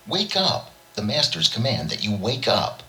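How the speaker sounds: high sharp command; shouted. A narrator's reading. George shouts at Lil to wake up, high commanding call. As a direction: bark the wake-up order up high. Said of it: "snapped his fingers and shouted".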